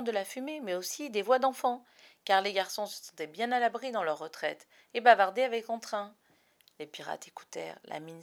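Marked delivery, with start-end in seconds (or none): none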